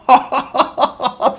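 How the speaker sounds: loud, high burst of delighted laughter. Real, not acted. Delighted that tracking works.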